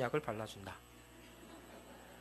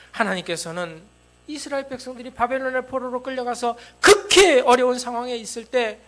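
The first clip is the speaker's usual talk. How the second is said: shouted, much higher preaching climax; impassioned revival preacher at full cry; full-force preaching; 10 semitones higher, 21 dB louder than usual. Acted. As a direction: thunder it out to the congregation. Climax of the sermon.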